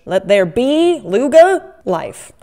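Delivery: loud, slow mock-godly decree; grand proclamation. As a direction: proclaim it like bringing it to life. Grand creation pronouncement.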